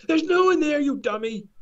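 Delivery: high, loud jeering shout; mock yell. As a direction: yell at a fool.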